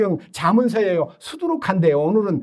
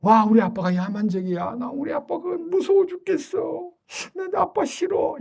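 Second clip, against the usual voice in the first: mock child afraid of his dad; scared child; 9 semitones higher than usual. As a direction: whimper it like a scared little boy. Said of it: "그러면 그 아이가요".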